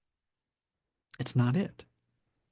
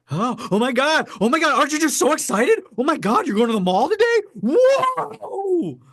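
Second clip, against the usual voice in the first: much higher, much louder gushing squeal; mock overexcited gushing; 11 dB louder than usual. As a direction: ham up a friend going wild over nothing. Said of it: "So people that get excited".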